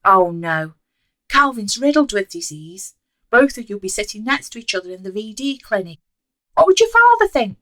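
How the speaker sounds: loud, rising horrified shriek. A narrator's reading. Mother shrieking at her daughter about disease, horrified. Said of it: "Joanne shrieked".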